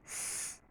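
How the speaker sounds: स- as a long whistled hiss through joined teeth. Shown as a demonstration. Teacher showing the whistling seen sound. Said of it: "ऐसे, ठीक है".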